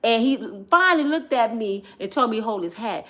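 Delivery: ordinary delivery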